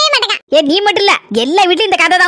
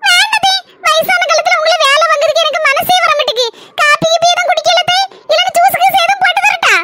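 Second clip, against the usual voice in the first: put-on higher, overly sweet young bride voice; sweet daughter-in-law; 8 semitones higher than usual. Daughter-in-law fussing over her mother-in-law, sugary higher voice. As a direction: coo it at the old lady.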